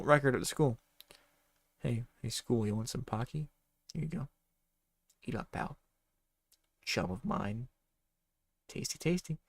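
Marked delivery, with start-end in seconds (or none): 1.8-9.4 quiet. Real, not acted. Player offers snack mid-scene.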